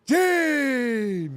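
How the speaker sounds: high, breathy, long-held wrestler call; Ric Flair. Acted. Host calls out to the wrestling interviewer, drawn-out wrestler impression. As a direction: hold each word way out, high and breathy. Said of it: "one phrase as Ric Flair".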